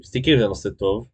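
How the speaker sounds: ordinary delivery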